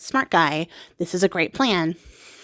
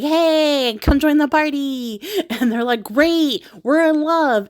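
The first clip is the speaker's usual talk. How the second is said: excited welcome; 6 semitones higher, 3 dB louder, slower than usual. Retold or imitated.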